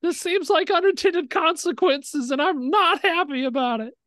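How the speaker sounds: squeaky high little voice; the shrunken Minotaur pipes up; tiny squeak. Acted.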